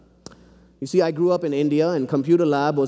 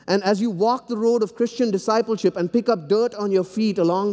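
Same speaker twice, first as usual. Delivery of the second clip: higher, ringing sermon climax; preaching at full force; 6 semitones higher than usual. Acted. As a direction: lift the congregation to the cross. Sermon appeal on confession.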